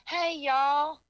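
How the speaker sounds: high, drawn-out Southern "hey, y'all"; put-on Southern greeting. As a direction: play up a Southern drawl. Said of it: "It is not where I'm from, or I'd be like".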